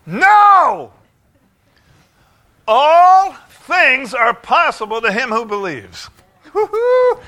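high, loud, slow declaration ending in a whoop; exultant preacher's roar; full-force preaching. Real, not acted. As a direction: shout it to the back row.

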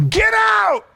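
sharp, high, loud rebuke; loud high commanding "Get out!"; commanding shout. Retold or imitated. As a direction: cast the demon out with one yell. Jesus rebukes the demon.

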